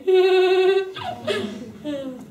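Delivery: a big, high laugh; loud laugh. Real, not acted. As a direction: burst out laughing. Laughs at her own joke.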